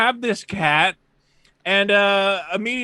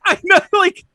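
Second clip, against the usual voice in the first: exasperated; 4 semitones higher, 4 dB louder than usual. Real, not acted. Exasperated complaint.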